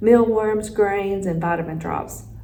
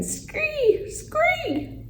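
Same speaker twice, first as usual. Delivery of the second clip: very high, thin scream; frog screech; 3 dB quieter than usual. A narrator's reading. Frog bashing the bag to save friend, screeching. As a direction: shriek in falsetto without much volume.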